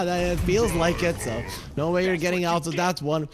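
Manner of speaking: usual speaking voice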